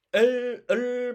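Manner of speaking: L and 을 held high and loud; sustained, forceful L of 을. Shown as a demonstration. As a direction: lean hard on the L in 을. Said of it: "시작하는 힘이 다르죠".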